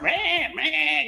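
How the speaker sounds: high, drawn-out goat bleat on each word; bleating goat impression. Goats begging their owner, bleating high. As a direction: bleat like one of his goats. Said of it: "you know, goats and shit".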